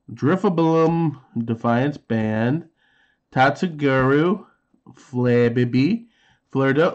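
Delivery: ordinary talk, nothing marked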